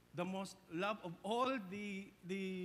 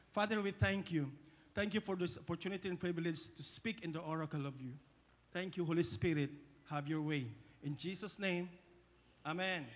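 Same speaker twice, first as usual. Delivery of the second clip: prayer; 5 semitones lower than usual. Acted. Closing prayer.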